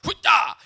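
very loud, high mock karate cry; explosive martial-arts shout, hammed up; karate yell. Dad showing giggling kids a karate chop, mock battle cry.

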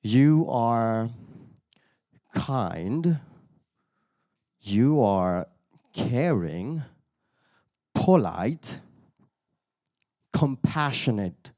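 slow, word by word, long pauses; speaking while writing. Retold or imitated. Manager writing her feedback while saying it to him, slow and halting. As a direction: dole out each word as if writing it down. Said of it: "she was writing, and she was talking to me same time".

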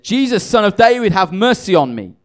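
a blind beggar crying out for mercy. Acted. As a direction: call out to Jesus for mercy. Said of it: "he began to cry to him".

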